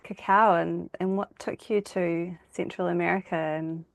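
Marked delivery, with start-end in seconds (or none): none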